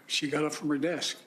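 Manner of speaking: ordinary delivery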